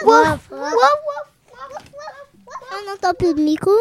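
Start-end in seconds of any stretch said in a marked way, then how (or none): 0.1-1.3 slow, high barking; dog barks. Pretend. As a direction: bark like a little dog. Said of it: "comment ça fait le chien".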